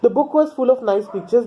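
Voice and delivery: slow, careful read-aloud of the English text; measured storybook reading pace; slow story reading. A narrator's reading. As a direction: read each sentence out slowly for the class. Rereads English line slowly.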